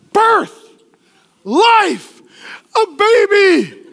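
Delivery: high, ringing exclamations, spaced apart; triumphant shout. Real, not acted. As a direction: cry out each word like an announcement. Preacher shouting climax of birth.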